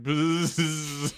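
a high, buzzing fly noise. Pretend. Player as a fly buzzing at Sariel, high buzz. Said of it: "bzz over to Sariel and be like".

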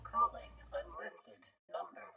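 very high, thin whispery announcement; automated watch voice. Acted. Watch announces a call to Eugenia, high and whispery. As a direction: speak as a tiny device.

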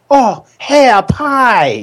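drawn-out reveal. Acted. Announcing the drinking word.

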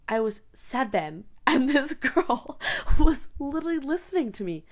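normal talking voice

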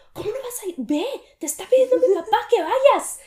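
high, loud scolding as the sister. Retold or imitated. Sister scolding Ivania to go to the counter, shrill and loud. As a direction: shriek at her sister to obey their dad. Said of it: "me levantó la voz y me dijo".

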